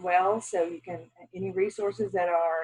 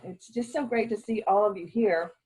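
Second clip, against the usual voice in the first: normal talking voice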